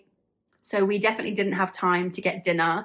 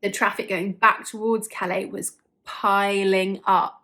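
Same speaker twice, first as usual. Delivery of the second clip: ordinary talk, nothing marked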